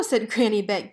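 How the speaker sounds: usual speaking voice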